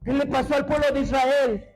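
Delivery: fervent preaching. Acted. Pastor preaching to his congregation, fervent and loud. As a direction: preach it at full force, pleading and commanding.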